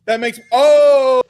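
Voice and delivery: a slow, loud burst of understanding; sudden oh. Real, not acted. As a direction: it suddenly clicks, let the oh ring out slow and loud. Sudden realization about his accent.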